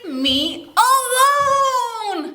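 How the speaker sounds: high, slow wailing plea to be left alone; old woman's cry. Acted. Old woman cries out to everyone pestering her, high and drawn out. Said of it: "Yep, she says".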